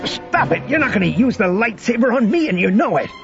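high, strained command to stop; heated, high-pitched outburst; urgent protest. Acted. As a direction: shout it out mid-struggle, pitched high. Han wrestles Luke for lightsaber.

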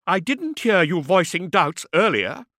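heated high-voiced retort; fiery retort. A narrator's reading. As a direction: fire it straight back at your accuser. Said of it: "she retorted".

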